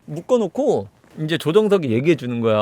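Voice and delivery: ordinary talk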